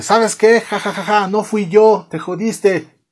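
high, scornful retort with fake laugh; mock angry taunt. Kevin angrily taunts his father, mock angry. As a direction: play a teen throwing it back, hammed-up. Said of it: "Kevin se enoja y dice".

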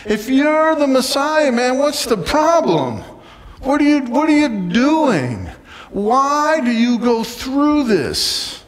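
high, insistent mock demand; exasperated challenge. Voices criminal challenging Jesus.

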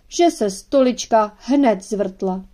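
plain reading voice

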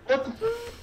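very high squeal cut off; startled cry. Real, not acted. Friend caught off guard in game, high startled squeal. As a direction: yelp it, then choke the word off.

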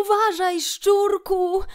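high, loud call of alarm; sudden warning cry. A narrator's reading. Kret warns Szczur before the boat hits, sudden cry. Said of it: "zawołał nagle Kret".

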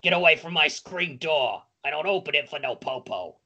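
put-on raspy old lady who smokes; old smoker lady. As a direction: play a chain-smoking granny. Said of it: "an old lady who smokes too much".

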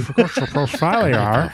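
mock Australian accent. Echoing the accent bit.

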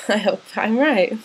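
laughing. Real, not acted. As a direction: giggle out the hope, half embarrassed. Laughing at her own hope.

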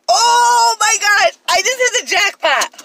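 high, loud excited cry; thrilled jackpot shout. Real, not acted. Vlogger telling viewers about a dumpster jackpot, excited shout. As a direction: burst out with real joy at the find.

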